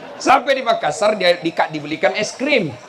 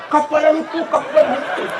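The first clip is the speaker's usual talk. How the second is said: put-on excited children calling; 8 semitones higher than usual. Kids chasing a passing plane.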